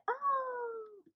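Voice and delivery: mock chick voice, high and quiet; voicing the chick. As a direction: play the chick catching on. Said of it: "They're like".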